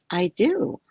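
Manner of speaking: a hint of a playful God voice; God's voice. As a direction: answer as a cheeky God. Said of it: "That's God".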